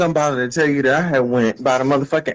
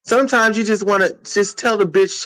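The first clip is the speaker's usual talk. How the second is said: ordinary talk, nothing marked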